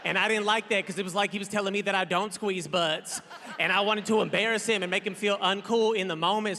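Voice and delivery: ordinary talk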